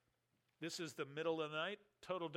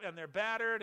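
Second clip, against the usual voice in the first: ordinary talk, nothing marked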